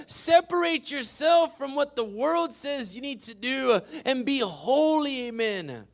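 forceful preacher's cadence; preaching. Real, not acted. Pastor urging the church to be set apart, preaching. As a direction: punch the key words like a preacher.

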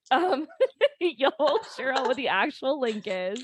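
high, giggly delivery; laughing. Real, not acted. Laughing at own muddled link.

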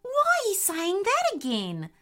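high-pitched exasperated complaint; exasperated frogmouth. A narrator's reading. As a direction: scold a foolish friend, pitched high.

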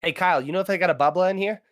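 loud, high, quick Boston bro voice; Boston accent. Acted. A guy asking his buddy about a drinking fountain, in a loud Boston accent. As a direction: bark it out like a Southie local. Acting out a sentence with bubbler.

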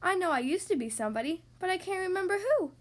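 plaintive, rising toward the end; mildly tearful, building into a whine; sniffling. Acted. Mister Nobody explaining his lost identity, sniffling. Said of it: "he sniffled".

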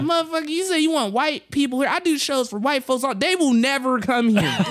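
high-pitched comic rant; mock outraged roast. Comic roasting the host to his face, mock indignation. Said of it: "started going in on 'em".

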